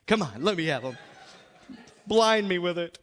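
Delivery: usual speaking voice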